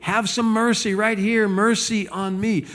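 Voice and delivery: high-pitched, drawn-out plea; crying out for mercy. Acted. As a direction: call out to Jesus like a desperate beggar. Reading blind man's cry to Jesus.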